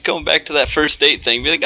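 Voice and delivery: usual speaking voice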